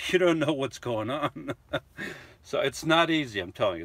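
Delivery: usual speaking voice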